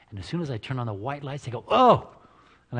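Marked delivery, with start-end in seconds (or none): 1.7-2.1 startled oh. Retold or imitated. Guests startled when lights switch.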